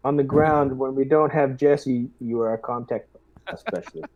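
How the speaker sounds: normal talking voice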